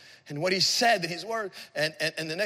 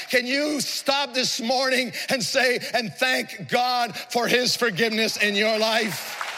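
impassioned preaching; 6 semitones higher than usual. Real, not acted. Preacher urging the congregation to thank God for forgiveness, impassioned. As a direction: preach it out with full force.

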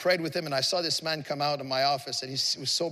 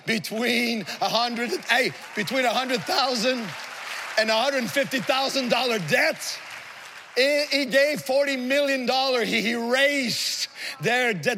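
raised, amazed pulpit delivery, stretching key words; impassioned preaching; 6 semitones higher than usual. Real, not acted. Preacher telling congregation of erased student debt, impassioned. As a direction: drive home the huge gift to the crowd.

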